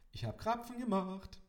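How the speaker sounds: high, loud singsong call from the kitchen; trilled call. A narrator's reading. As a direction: sing it out like a cheerful mother. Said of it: "trällerte es aus der Küche".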